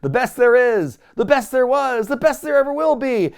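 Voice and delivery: high, loud building announcer call; introducing Bret Hart to Calgary; ring announcer. Retold or imitated. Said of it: "And he's like".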